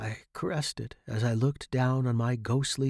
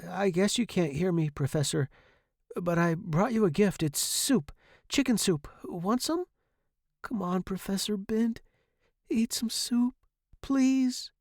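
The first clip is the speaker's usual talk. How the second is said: higher-pitched gentle coaxing; coaxing student; 8 semitones higher than usual. A narrator's reading. Student offering soup to sick professor, coaxing. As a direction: coax him in a light, high voice.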